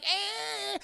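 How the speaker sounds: high, drawn-out squeal; mock scream. Women freaking out at noises upstairs, high mock squeal. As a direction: squeal in pretend fright.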